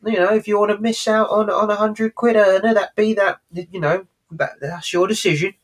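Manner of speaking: lightly voiced cocky Del Boy. Recapping Del Boy persuading Rodney, light impression. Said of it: "Del Boy says".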